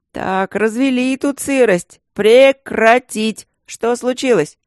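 loud, high, slow reprimand; stern scolding. A narrator's reading. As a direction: bark orders at the weepers. Mage scolds the crying women.

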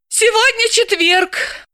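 Galka sighing. Acted. Galka answering Dalila with a slow sigh, high voice. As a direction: sigh it out as the friend. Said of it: "вздохнула Галка".

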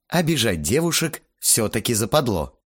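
usual speaking voice

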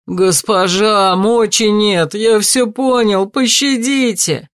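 slow, high desperate begging; pleading. A narrator's reading. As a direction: beg for your life. Begging for mercy.

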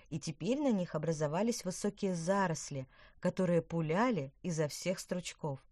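ordinary delivery

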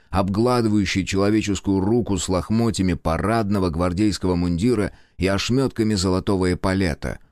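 plain reading voice

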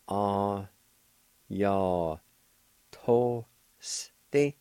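Ah ya to ste with each syllable set apart. Shown as a demonstration. Teacher sounding out the word for listeners. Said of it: "it's pronounced like this".

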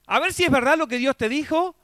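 mocking taunt. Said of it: "no como diciendo".

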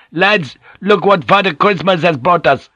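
high, loud, triumphant shout. A narrator's reading. Van Neck announcing his friend over raucous singing, shouting. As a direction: shout it over a noisy crowd. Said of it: "shouted over the raucous singing".

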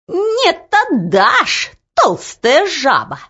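high, squealing struggle voice; furious insult. A narrator's reading. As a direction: spit the insult mid-tussle. Two men fighting over key.

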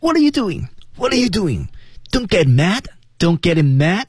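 嗓子压住，音调很高地挤出来; 像小鸟一样高声挤着嗓子提醒; 压着嗓子. Shown as a demonstration. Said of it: "嗓子压". (压嗓高音挤声)

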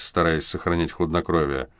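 plain reading voice

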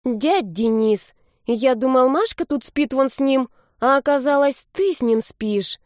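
put-on a boy let down, stretching his words; disappointed child. A narrator's reading. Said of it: "Ребёнок скорбно покачал головой, он был крайне разочарован".

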